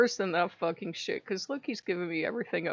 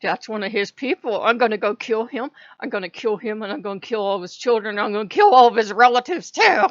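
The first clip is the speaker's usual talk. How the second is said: louder, rising angry rant; angry threats; 9 dB louder than usual. Retold or imitated. Vowing revenge on a god's people, angrily building. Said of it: "I'm like".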